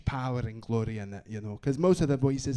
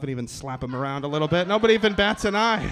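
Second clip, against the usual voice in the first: ordinary talk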